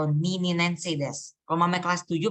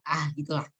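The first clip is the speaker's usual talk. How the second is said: normal talking voice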